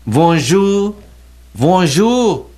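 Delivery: "Bonjour" said high and loud with a French accent; French greeting. Shown as a demonstration. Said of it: "Ándale, te toco".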